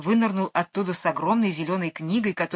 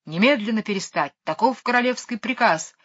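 ordinary delivery, nothing marked

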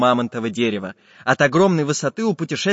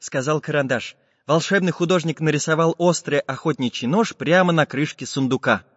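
plain reading voice

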